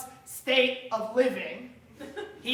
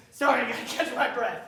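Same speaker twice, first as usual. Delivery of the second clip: usual speaking voice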